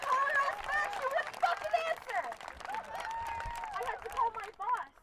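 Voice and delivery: high, slow furious yell; furious shout. Real, not acted. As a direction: shout it out in real anger. Furious rant at a protest.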